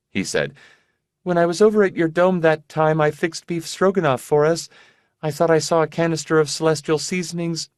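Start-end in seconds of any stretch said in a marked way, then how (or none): 1.2-7.7 lightly voiced sick young woman, high; airy, distracted girlish voice; high fragile young woman. Rebus voiced by narrator.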